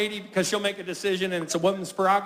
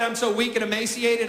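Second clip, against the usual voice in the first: ordinary talk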